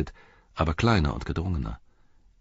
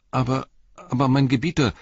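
high, flustered stammer, louder; 7 semitones higher, 4 dB louder than usual. A narrator's reading. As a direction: stumble over the words in alarm. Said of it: "stotterte Merry". (hoch und stotternd, aufgeregt)

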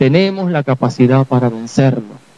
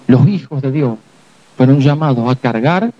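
normal talking voice

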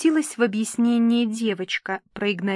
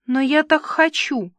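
higher, louder plea; tearful insistence, lightly acted; 6 semitones higher, 5 dB louder than usual. A narrator's reading. Nina, eyes filling with tears, pleads for her dress, insistent. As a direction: insist like an upset bride.

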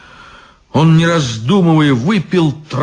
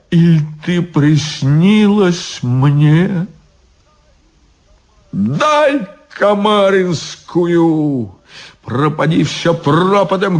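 put-on drunk guard's plea; 6 semitones higher, slower than usual. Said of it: "drunk guard's outburst".

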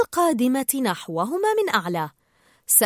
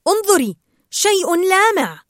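distracting exclamation; 9 semitones higher, 7 dB louder than usual. A narrator's reading. Marlin luring Dory away from the trench, feigning excitement. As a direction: stretch it out to lure attention away. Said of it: "حاول تشتيت انتباهها".

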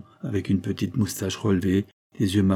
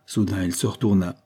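ordinary delivery, nothing marked

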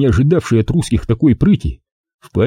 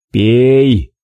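calm and hard; 3 dB louder than usual. A narrator's reading. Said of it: "спокойно и жёстко сказал он".

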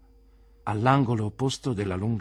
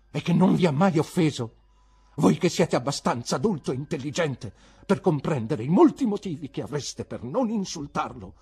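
high, loud, wounded reproach; upset teacher's heated rebuke; agitated indignation; 7 semitones higher, 7 dB louder than usual. Acted. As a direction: plead your case against the insult. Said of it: "con le labbra ancora più tremanti".

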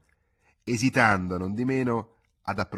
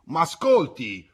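slow, high, loud call to someone leaving; shouted after him; 8 semitones higher, 9 dB louder, slower than usual. A narrator's reading. Old Giacco shouting after the departing boy, slow and loud. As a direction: call out to stop him walking away. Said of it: "Giacco gli gridò dietro".

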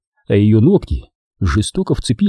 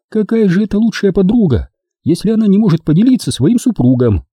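put-on higher young woman's voice, teasing; Дженни; 9 semitones higher than usual. A narrator's reading. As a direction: play the girlfriend, sweet and teasing. Jenny voiced. (нежная игривая Дженни)

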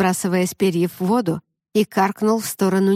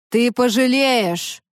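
slow, higher menacing mermaid; thundering mermaid threat; 4 semitones higher, slower than usual. Acted. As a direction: threaten like a roaring river. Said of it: "вмиг изменился ... в грохот горной речки".

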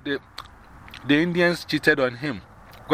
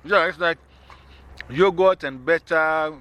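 ordinary talk

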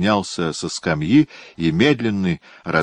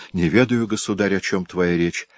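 ordinary delivery

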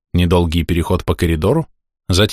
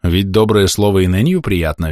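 ordinary delivery

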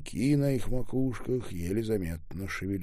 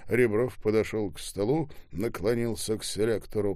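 ordinary delivery, nothing marked

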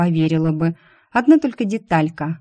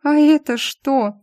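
high, slow squeaky question; squeak; 8 semitones higher, 3 dB louder, slower than usual. Acted. Heroine squeaks at the marks on his wrists, high. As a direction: squeak it out in alarm. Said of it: "пискнула я".

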